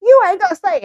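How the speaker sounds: high loud mock yell; friend yelling. Friend yelling that she won't stay, high and loud. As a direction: shout it like an outraged friend. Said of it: "My friend's like".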